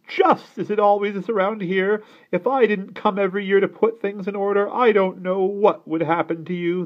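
high, loud scolding; self-important, critical aunt. A narrator's reading. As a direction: lecture them as the one who fixes everything.

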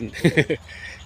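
short, loud breathy laugh; brief burst of real laughter. Real, not acted. Gardener after saying the graft must take first, short laugh.

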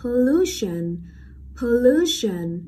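light po, strong drawn-out LU in pollution; stress on middle syllable. Shown as a demonstration. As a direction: hit LU and let pollution fall away. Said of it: "trọng âm sẽ rơi vào âm tiết đứng ngay trước nó".

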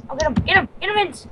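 ordinary talk

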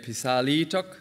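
pisalitok with a long, stressed LI; lengthened accented LI. Shown as a demonstration. Lecturer showing the question form, LI lengthened in pisalitok. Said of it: "primary accent on li, it has to lengthen".

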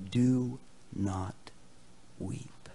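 slow, hushed comfort, fading almost to breath; softly console, leaving space between words; gentle exhortation. Acted. Elder comforting John as he weeps, gentle exhortation. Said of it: "my words of encouragement to you".

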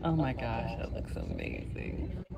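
breathless, hushed oh my gosh; awed hush. Real, not acted. Vlogger hushed in awe at the dessert. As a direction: gasp it out in awe. Gasping at caramel pour reveal.

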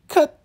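short, loud falsetto shriek; hammed-up squeal of pain; mock scream. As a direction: imitate a sudden high scream. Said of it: "she's screaming".

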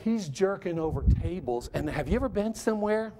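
usual speaking voice

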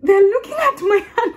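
high, loud astonished what; mimicked surprise. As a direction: imitate startled coworkers in a high voice. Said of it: "they're so surprised".

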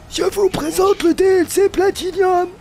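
high, slow showman announcer voice. Pretend. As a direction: present it like a grand launch. Grand mock product announcement.

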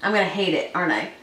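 nibbling a chip; talking while chewing. Real, not acted. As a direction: mouth partly full. Seen 0: Woman talks with a chip at her lips, biting and chewing between words.

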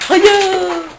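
excited greeting. Pretend. Monkey greets child.

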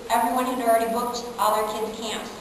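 ordinary talk

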